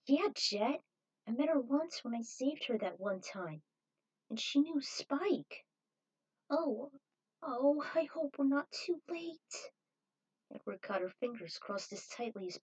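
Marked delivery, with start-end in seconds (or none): 0.1-9.7 higher, light female figure; higher character voice. Acted. Zero tells Jet about Julia, higher voice. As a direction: voice her a little higher, hopeful.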